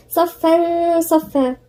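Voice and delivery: slow صفا صفا with a held nasal between; ghunnah demonstration. Shown as a demonstration. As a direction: hum the "n" ending the first صفا into the second. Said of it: "وغنة ليه؟". (غنة ممدودة في "صفا صفا")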